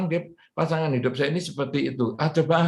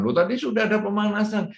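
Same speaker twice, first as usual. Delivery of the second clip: mock protest; 8 semitones higher, faster than usual. Shown as a demonstration. Demo of objecting listener.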